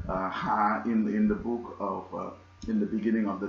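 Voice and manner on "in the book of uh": usual speaking voice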